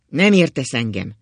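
loud, vehement retort; heated. A narrator's reading. Old husband to his wife who misses his point, heated. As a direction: fire back with temper. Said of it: "felelt az öreg indulatosan".

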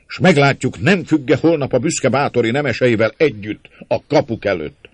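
defiant, loud, high-pitched declamation; rally them with furious resolve; angry cry. A narrator's reading. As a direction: build from firm defiance into a heated cry. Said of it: "kiáltott György még nagyobb indulatban".

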